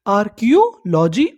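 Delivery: Archaeology slowed as आर-कियो-लॉजी, rising; syllable by syllable. Shown as a demonstration. Teacher asking learners what the study is called, slow and rising. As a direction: break archaeology into slow lifted parts. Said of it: "उसे हम लोग क्या बोलते हैं".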